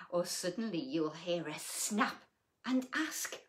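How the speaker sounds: lilting storybook read-aloud; reading to children. A narrator's reading. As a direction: read it like a bedtime picture book. Reading picture book aloud.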